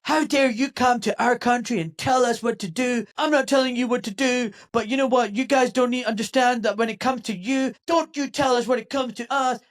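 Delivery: loud, high mock outrage; talk-show quarrel. Host mimicking politicians arguing like Jerry Springer guests, mock quarrel. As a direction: imitate indignant guests squabbling on trash TV. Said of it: "It was literally like the Jerry Springer show".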